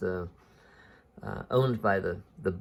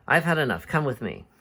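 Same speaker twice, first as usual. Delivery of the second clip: exasperated captain, higher and louder at the start; fed up; 6 semitones higher, 4 dB louder than usual. Acted. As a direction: had it up to here, acted. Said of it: "Haddock has finally had enough with him".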